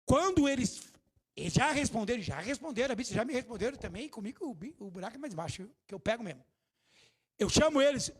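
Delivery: ordinary delivery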